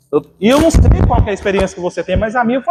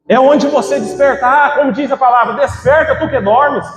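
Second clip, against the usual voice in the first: rousing preaching; 4 semitones higher than usual. Acted. Preacher summons the congregation to wake up, rousing. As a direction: ring out the scripture like a wake-up call. Preaches at full force, quoting scripture.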